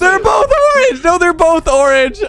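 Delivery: high, loud, slow wail of disbelief; excited shout. Real, not acted. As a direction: cry it out in stunned disbelief. Realizes goals are both orange.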